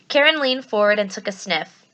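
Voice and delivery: plain reading voice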